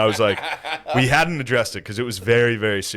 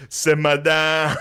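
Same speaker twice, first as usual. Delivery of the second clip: slow, laughing "simmer down"; crowd through laughter; 5 semitones higher, much slower than usual. Retold or imitated. The crowd laughing at the comics, slowly. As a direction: draw it out while laughing. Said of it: "people were like".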